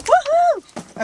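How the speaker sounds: high, loud whoop of joy; excited cheer. Real, not acted. Vlogger whooping to viewers about tea, high and loud.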